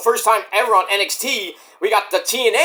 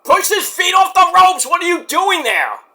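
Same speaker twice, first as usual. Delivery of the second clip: yelling at the TV; 10 semitones higher than usual. Retold or imitated. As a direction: shout at the screen. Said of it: "I'm like".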